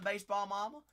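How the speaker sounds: ordinary talk, nothing marked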